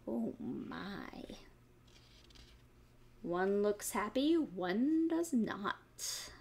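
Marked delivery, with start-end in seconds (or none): none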